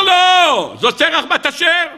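a pupil shouting out the answer he thinks is right; eager student. Retold or imitated.

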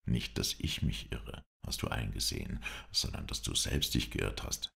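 low, softened, confidential reply; muted voice. A narrator's reading. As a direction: keep it hushed, for his ears only. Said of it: "entgegnete ich mit gedämpfter Stimme".

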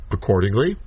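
normal talking voice

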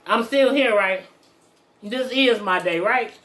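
chewing while talking; talking around food; mouth full. Real, not acted. Seen 1: Fork bite at -2/-1; lips tight, chewing-like during words.

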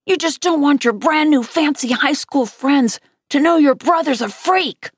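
high, bitter accusation; child lashing out at sister; angry yelling. A narrator's reading. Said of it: "I yelled".

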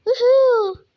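high, loud fake boo-hoo; hammed-up crying sound; mock sob. Real, not acted.

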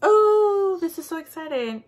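high, loud burst of delight; thrilled squeal. Real, not acted. Unboxing her advent calendar, she squeals with delight. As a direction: squeal over the surprise inside.

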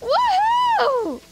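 very high whooping cheer; excited whoop. Real, not acted. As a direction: whoop with pure joy. Celebrating the win.

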